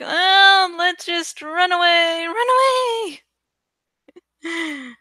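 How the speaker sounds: high squealing run away, voice cracking; mock panic, fleeing in a high wail; cowardly Brave Sir Robin. Her mouse hand fleeing like Sir Robin, high panicked cry. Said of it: "my grasp on my own mouse was like".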